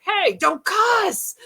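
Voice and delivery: very high, drawn-out mock scolding; high-pitched stretched telling-off; imitated ghost scolding. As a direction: call out a slow, high reprimand like a spirit. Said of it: "and then he's like".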